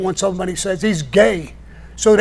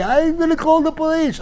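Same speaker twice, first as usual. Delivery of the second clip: high, whining mock of a crying man; whiny threat; 9 semitones higher than usual. Mocking the crying guy who got slapped, high whine. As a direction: squeal it like a crybaby threatening. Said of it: "He was screaming, crying".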